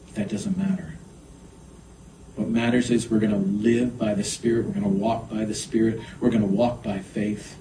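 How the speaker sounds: soft, low praying voice with long pauses; hushed prayer. Real, not acted. Pastor prays to Father for church's assignments, hushed. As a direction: pray quietly, leaving space between phrases. Leads congregation in closing prayer.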